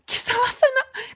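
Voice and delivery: stammered, breaking into high squeak; mock-crying helpless question; tearful bewilderment. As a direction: sputter it softly, near tears. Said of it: "ya, ahí ya llorando".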